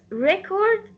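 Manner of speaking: "record" as a noun, weight on REC; stress on first syllable. Shown as a demonstration. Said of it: "stress will go on the first syllable".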